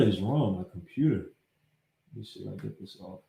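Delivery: very soft, low mumble; barely voiced aside while troubleshooting; muttering to himself. Real, not acted. Talking to himself, computer trouble.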